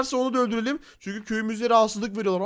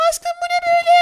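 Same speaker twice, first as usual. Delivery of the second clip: put-on girly high spider girl voice; faster than usual. (çok ince sesli örümcek kız)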